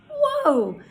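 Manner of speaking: high, drawn-out whoa of wonder; playful amazement. Pretend. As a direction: act impressed, voice leaping up high. Reacts to her syllable count.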